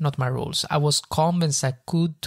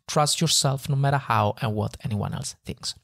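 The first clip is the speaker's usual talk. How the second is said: ordinary talk, nothing marked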